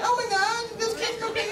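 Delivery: high-pitched startled squeal; squeaky high voice. Retold or imitated. Young boy squealing in shock at his first kiss. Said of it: "no idea why I was talking like that".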